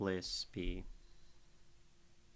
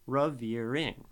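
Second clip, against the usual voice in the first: revering slowly, long loud middle syllable; stress on the middle of revering; 4 dB louder, slower than usual. Shown as a demonstration. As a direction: draw out "veer" in revering, short either side. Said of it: "It's short, long, short".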